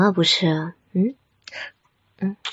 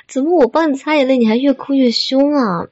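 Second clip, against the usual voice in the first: louder, higher, fussing dismay; fond exasperation; 4 semitones higher, 7 dB louder than usual. Acted. Girlfriend whose partner cries harder as she wipes tears, exasperated. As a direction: fuss helplessly over their tears. Fussing as crying gets worse.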